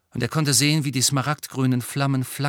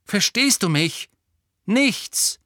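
insistent; 10 semitones higher, 5 dB louder, slower than usual. A narrator's reading. Lupin pressing the point.

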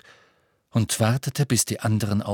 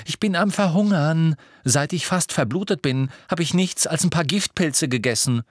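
heated, higher-pitched complaint; irritable growling; 7 semitones higher than usual. A narrator's reading. Starving Ron complains to Hermine, irritable. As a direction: grumble angrily with raised pitch. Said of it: "knurrte er Hermine an".

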